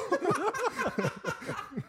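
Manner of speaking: risa aguda y sostenida; carcajada. Real, not acted. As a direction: risa real que interrumpe el relato. Se ríe contando la anécdota. (carcajada al recordar la anécdota)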